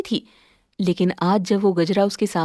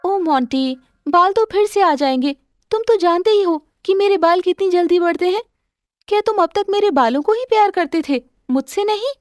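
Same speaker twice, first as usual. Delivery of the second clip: higher, hurt questioning voice, quick; hurt wife; 6 semitones higher than usual. Acted. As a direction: ask him in hurt disbelief. (आहत पत्नी का तेज़ ऊँचा सवाल)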